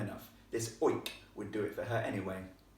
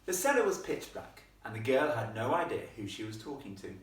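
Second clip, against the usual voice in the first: plain reading voice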